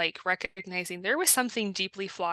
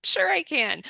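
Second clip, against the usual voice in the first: high, breezy mock confidence; confident retort; 8 semitones higher, 3 dB louder than usual. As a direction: toss it back high, lightly overconfident. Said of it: "then you're going".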